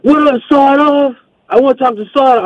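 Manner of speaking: squeaky stand-in for Cliff, teasing; mock Cliff voice. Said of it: "I hear".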